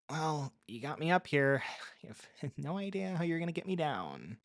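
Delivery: rueful half-laugh with a sigh; amused at her own predicament; wry musing. A narrator's reading. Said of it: "I mused".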